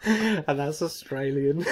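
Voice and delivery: giggling through the words, slow; laughing at his own joke; slow giggly delivery with a high squeak of laughter. Real, not acted.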